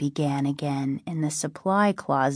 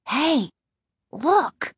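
higher, mildly urgent call; crying out; 8 semitones higher than usual. A narrator's reading. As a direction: call out to point at a discovery. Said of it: "Casey cried".

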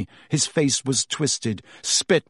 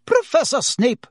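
much higher, louder shriek; 13 semitones higher, 4 dB louder than usual. Acted. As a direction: shriek to stop him. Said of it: "shrieked Madame Pomfrey".

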